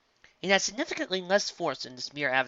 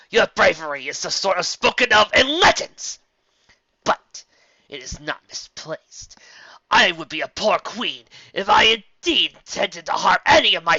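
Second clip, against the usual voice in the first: loud, high, commanding queen; imperious address; 8 semitones higher, 12 dB louder than usual. Acted. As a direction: lord it over the guards, booming. Celestia addresses guards, imperious.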